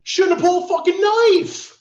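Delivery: high, loud, furious retort; angry retort. Retold or imitated. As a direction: yell it back in righteous anger. Retorting in the fight.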